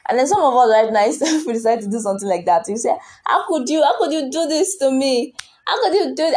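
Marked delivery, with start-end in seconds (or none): 3.2-6.4 high, pleading how could you; mock wounded protest. Congregation member protesting a sacrifice, high wounded whine. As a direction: whine it like the betrayed one. Mock accusing cry of betrayal.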